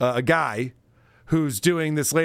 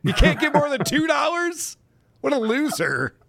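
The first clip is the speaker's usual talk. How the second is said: higher-pitched mock scorn; mock insult on request; 8 semitones higher than usual. Host answers a chatter asking to be called a loser, mock scorn. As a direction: play up the scorn for a teasing put-down. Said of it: "like to see you call me a loser".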